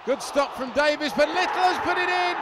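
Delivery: excited shout. Real, not acted. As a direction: burst out as the ball goes in. Sudden goal in live commentary.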